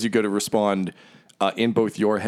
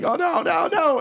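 very high acted panic; hammed-up high-pitched dread; panicked victim. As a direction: squeal the repeated no in mock terror. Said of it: "just be like".

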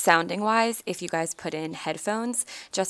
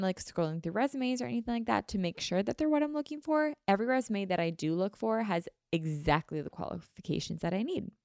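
softer, even podcast sponsor read; 5 dB quieter than usual. Acted. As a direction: read the Indeed spot smoothly.

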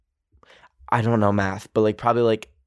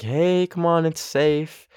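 coaxing; 6 semitones higher, slower than usual. Retold or imitated. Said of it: "coax it over".

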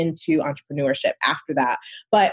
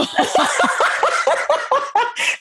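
high, breathy laugh; big laugh. Real, not acted. Guest laughing about her cheap mortgage, high laugh. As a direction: burst out laughing.

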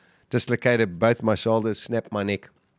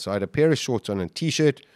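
usual speaking voice

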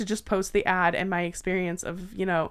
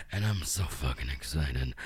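growl; 7 dB quieter than usual. Acted. Spooky growled excitement.

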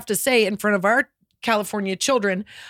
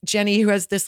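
ordinary talk, nothing marked

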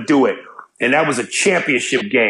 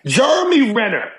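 ordinary talk, nothing marked

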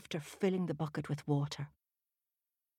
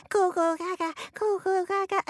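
put-on high, babbling baby voice; baby babble; 13 semitones higher than usual. A narrator's reading. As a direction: Finn pretending to be a baby. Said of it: "cry like a baby".

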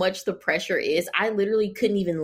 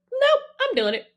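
defiant refusal; 13 semitones higher than usual. Retold or imitated. Defiant refusal, high far.

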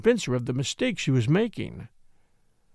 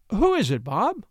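startled cry; 5 semitones higher than usual. A narrator's reading. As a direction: call up into the barn, half-startled. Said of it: "cried the tall one".

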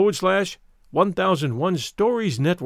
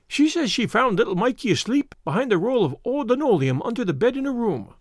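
lightly voiced Irish wife, higher pitch; Irish wife reporting; 6 semitones higher than usual. A narrator's reading. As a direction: relay the news in a raised lilt.

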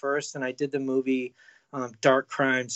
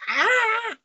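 a high, short mock scream of a ten-year-old; terrified kid scream; 4 dB louder than usual. Ten-year-old screaming at his Terminator brother, high mock shriek. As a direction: yelp like a scared little boy. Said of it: "I'm ten. I'm like".

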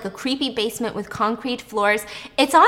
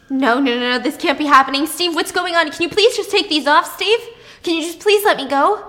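high, louder, mounting panic; panicked pleading; 6 semitones higher, 5 dB louder than usual. Acted. Chained Noah pleads with Steve to free her, panicked. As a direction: act out a desperate plea, raised pitch.

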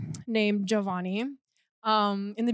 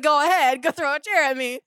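loud, higher taunting challenge; daring; 4 semitones higher, 5 dB louder than usual. Retold or imitated. Student daring classmate to throw a chair, taunting. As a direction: goad him on, re-enacted. Retold dare in a quarrel.

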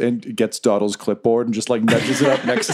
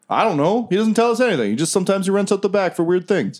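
a higher, pleading dwarf worker; 5 semitones higher than usual. Acted. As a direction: beg the intruders to leave you be. Dwarf dodges the questions.

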